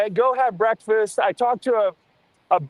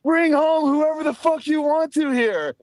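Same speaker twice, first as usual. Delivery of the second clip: high-pitched mock yelling; acted-out outraged holler, pitched up; retold angry shout; 6 semitones higher than usual. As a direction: imitate a man shouting as he bursts in. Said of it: "a dude fucking shouting at me".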